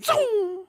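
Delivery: high vocal zoom; zoom sound. Retold or imitated. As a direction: make the car whoosh past. Imitating car boost.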